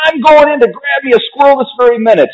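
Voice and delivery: high-pitched bratty threat; Veruca shouting. A narrator's reading. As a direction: announce it like a defiant spoiled girl. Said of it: "shouted Veruca".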